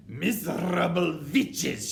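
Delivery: slow, high, loud witch insult; scolding witch tyrant; furious yell. A narrator's reading. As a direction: berate them at full voice, drawing it out. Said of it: "she yelled".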